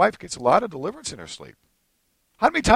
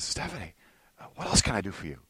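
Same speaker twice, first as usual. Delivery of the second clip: soft, quick offer to serve; hushed acted eagerness to please; tender doting; 6 semitones lower, 11 dB quieter, faster than usual. Husband serving his wife, tender and soft.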